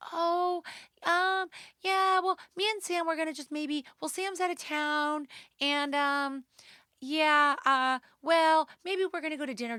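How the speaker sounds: high, awkward put-on voice full of ums; mock Abby. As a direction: imitate her squirming, fumbling for an excuse. Abby quoted, hesitant evasive reply.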